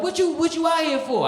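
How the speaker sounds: curious gangster question; Crip voice. Retold or imitated. Crip asks the comic why he came, high voice.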